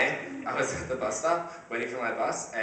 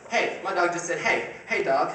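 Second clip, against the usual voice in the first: high, louder goofy voice for the dog; silly dog voice; 5 semitones higher, 5 dB louder than usual. Retold or imitated. Owner pretends his dog is greeting him, lightly goofy. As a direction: make the dog sound chatty.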